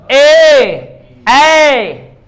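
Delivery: ए held out loud from the throat, mouth open; demo of ए. Shown as a demonstration. Teacher demonstrates ए to the class, loud. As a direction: call out ए as a long, loud vowel from the throat. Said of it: "आवाज भी आ रही है".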